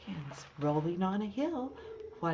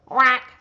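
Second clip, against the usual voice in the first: loud, high quacked word; 5 semitones higher, 17 dB louder than usual. Acted. The duck reveals herself under the mask, loud quack. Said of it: "says the duck".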